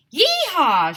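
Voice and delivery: high, loud whoop; Mister Mackey whooping over Gilver; shouted. A narrator's reading. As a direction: holler in triumph. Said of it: "shouted Mister Mackey".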